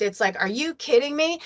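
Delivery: usual speaking voice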